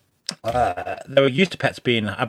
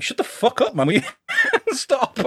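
mock annoyed; 11 semitones higher than usual. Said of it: "He's probably like".

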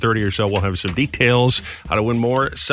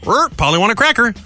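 higher squawky parrot voice; mock parrot; 8 semitones higher than usual. Radio host demonstrating what a parrot is, mock parrot squawk. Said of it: "This is a parrot".

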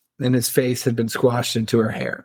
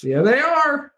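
much higher, comic sing-out; playful mock reply; slower than usual. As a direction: send the retold answer way up high. Repeats character's cheeky answer, amused.

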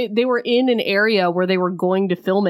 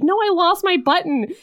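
put-on squeaky high girly voice; mock damsel; high mock heroine; 10 semitones higher than usual. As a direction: pitch it way up like a flustered heroine. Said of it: "she's like".

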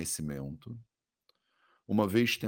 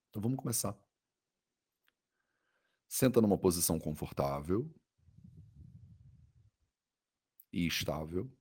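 soothing guided meditation. Acted. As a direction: guide the body scan gently, with long pauses.

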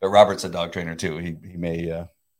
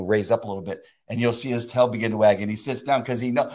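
usual speaking voice

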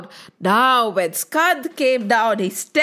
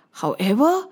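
ordinary delivery

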